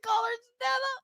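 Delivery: high, soft squeak through laughter; laughing. Real, not acted. Host quoting a joke, helpless high laughing voice. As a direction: squeeze the words out while cracking up.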